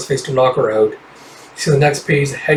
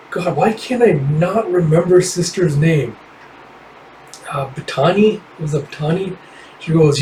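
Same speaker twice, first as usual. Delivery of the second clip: usual speaking voice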